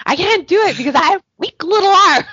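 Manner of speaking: loud, high, hammed-up complaint; mock helpless whine. Host replaying her line to Patrick, mock helpless wail. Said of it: "I'm like".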